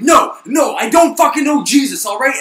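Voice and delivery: high, loud furious shout; angry denial shout. Retold or imitated. Peter shouting at the third woman, furious. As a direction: yell it, fed up.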